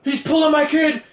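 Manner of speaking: high-pitched mock outrage; freaking out. Dad watching his son get benched, mock freak-out. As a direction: panic like an indignant sports dad, hammed up. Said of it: "Ryan Gosling's dad's freaking out".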